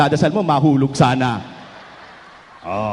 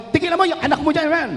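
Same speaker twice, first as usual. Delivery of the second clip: mock quarrel; 8 semitones higher, faster than usual. Sister snaps back in quarrel.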